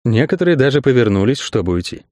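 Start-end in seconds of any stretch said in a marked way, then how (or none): none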